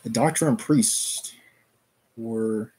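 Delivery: steady read-aloud narration; story narration. Acted. Streamer reading a classic novel to chat, steady narration.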